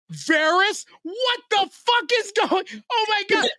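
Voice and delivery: very high, astonished squawk; astonished outburst. Retold or imitated. Reader stunned when Varys shows up, astonished outburst. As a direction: re-enact total shock, pitch shooting up. Retells shock at Varys appearing.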